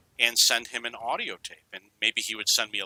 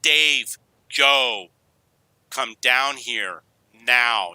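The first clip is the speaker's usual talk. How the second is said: mother's yell, louder and drawn out; retold yell; 6 dB louder, much slower than usual. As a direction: call the kids down with slow, loud, separate words. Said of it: "and she yelled".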